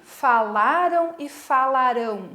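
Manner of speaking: "Falaram" stressed on LA, "falarão" stressed on final RÃO; contrasting stress. Shown as a demonstration. Teacher giving another example, LA in "Falaram", RÃO in "falarão". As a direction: set "Falaram" with strong LA against "falarão" with strong RÃO. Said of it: "a mesma coisa aconteceu no escreveram e no escreverão".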